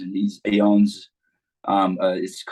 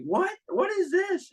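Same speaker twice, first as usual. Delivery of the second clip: high-pitched mock disbelief; squeaky-high incredulous question; baffled outcry; slower than usual. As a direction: imitate a crowd's bewildered reaction. Acted reaction of baffled crowd.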